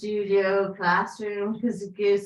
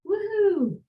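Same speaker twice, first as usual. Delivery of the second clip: high, bright whoop of delight; excited cheer; 10 semitones higher than usual. Real, not acted. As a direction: cheer them on with a playful whoop. Cheering on a mermaid pose.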